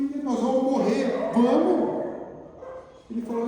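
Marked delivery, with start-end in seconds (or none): 0.0-2.1 put-on frightened boy. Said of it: "começou a chorar".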